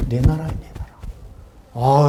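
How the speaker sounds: very slow, drawn out; savouring. Real, not acted. Preacher savouring owning the kingdom, very slowly. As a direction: relish each word.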